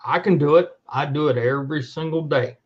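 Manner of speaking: ordinary talk, nothing marked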